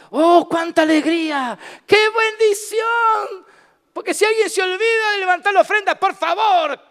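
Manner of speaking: high, loud, jubilant praise; joyful exclamation. Real, not acted. Preacher rejoicing to his congregation, loud and high. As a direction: burst out in joy over the blessing. Preacher exults over giving.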